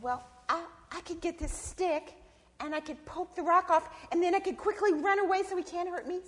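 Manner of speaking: high-pitched possum voice working out a plan; Sister Possum reasoning. Acted. Possum planning to poke off the rock, high hesitant reasoning. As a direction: think aloud in a high voice, gathering urgency.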